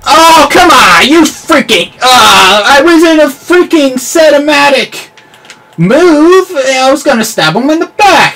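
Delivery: high, loud outraged yelling; real exasperated shouting; frustrated yell. Real, not acted. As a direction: rage at the teammate who ruined the play. Raging at teammate's kill steal.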